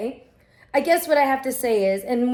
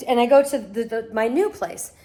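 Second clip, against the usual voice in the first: ordinary delivery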